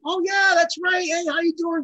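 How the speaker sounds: high, loud, hearty greeting; delighted recognition. Retold or imitated. Rock star remembering the drummer and greeting him, delighted.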